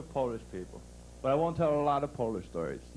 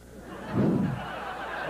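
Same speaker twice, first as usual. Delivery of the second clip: quick airy laugh at his own punchline; breathy laugh. Real, not acted. Comic laughing at his own joke with the crowd. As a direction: laugh at the joke you just told.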